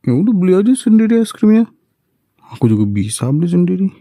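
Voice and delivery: high, fast, huffy refusal; huffy fast retort. Acted. Wife refusing husband's ice cream peace offering, fast and huffy.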